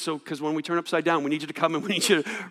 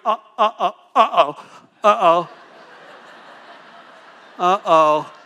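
loud, stuttering uh-oh of a falling sheep; a sheep flailing as it tips over; mock toppling sheep; 6 dB louder than usual. Toppled sheep panicking, stammering uh-oh.

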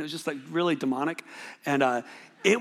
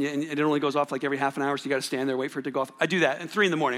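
ordinary talk, nothing marked

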